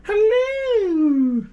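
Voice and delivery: high, loud excited hello; excitable dog voice. Shown as a demonstration. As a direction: greet a dog with a squeal. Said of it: "say something excitable".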